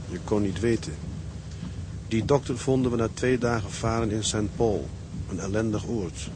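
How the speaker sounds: calm, even letter reading to his wife; reading a letter aloud. Acted. Sailor reading his letter home to his wife, calm and tender. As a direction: speak it as words written to a loved one.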